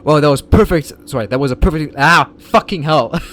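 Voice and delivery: frustrated outburst. Real, not acted. Flubbed line, frustrated outburst.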